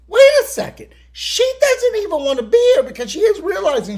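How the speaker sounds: outraged exclaim. Real, not acted. Outraged retelling, high shout.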